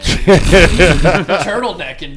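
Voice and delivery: long laughing drawn out word; laughing through it. Pretend. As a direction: drag the word out while cracking up. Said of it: "my money shot look".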